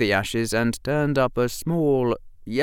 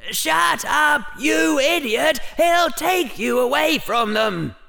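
much higher, louder frantic shout; screaming; 4 dB louder than usual. A narrator's reading. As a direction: scream a warning inside his head. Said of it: "screamed at the top of its mental lungs".

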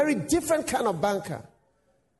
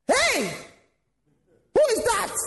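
calling out; 13 semitones higher than usual. Acted. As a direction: call out to a stranger far off. Onlooker calls out at lone man.

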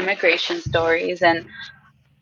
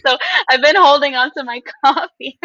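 laughing through the words; 9 semitones higher, 4 dB louder than usual. Real, not acted. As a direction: giggle while you say it. Laughing at herself mid-story.

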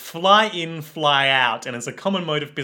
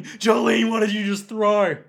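mock scream; 5 semitones higher, faster than usual. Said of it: "screaming and crying".

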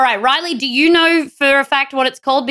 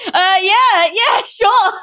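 laughing, overjoyed yes; 8 semitones higher, 3 dB louder, much slower than usual. Retold or imitated. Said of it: "I was like".

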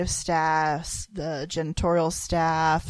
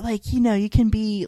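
ordinary delivery

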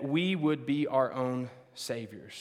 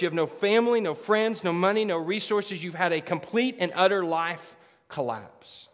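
usual speaking voice

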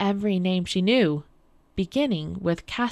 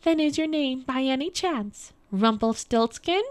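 high, teasing queen's question; spring the name in a raised voice; queen guessing; 6 semitones higher than usual. A narrator's reading.

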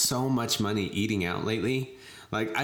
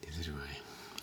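whispered aside, breathy and soft; 4 semitones lower, 10 dB quieter than usual. Real, not acted. Co-host whispers a tired reply, hushed.

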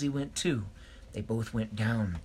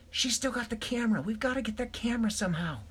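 lightly voiced older Dashie, higher; a touch higher, pressing; high urgent voice; 11 semitones higher than usual. A narrator's reading. Older Dashie urging the crew to get the camera, higher.

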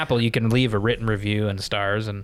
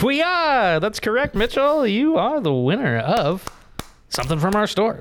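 high, excited prize announcement; announcing the winner; 9 semitones higher than usual. Acted. Host announcing the prize winner to Mitchell, high and excited. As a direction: cheer it out like a game show host.

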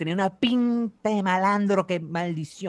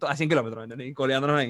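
ordinary talk

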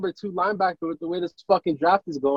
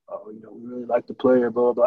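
lower, offhand mock coach voice; mocking Belichick; 6 semitones lower, faster than usual. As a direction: brush it off like a bored coach quoting clichés. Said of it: "Belichick's not gonna say anything".

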